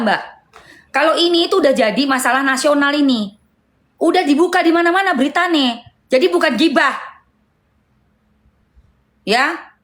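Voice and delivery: sharp lecture pitched well up. Real, not acted. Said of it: "gue naikin, eh, apa, intonasi suara".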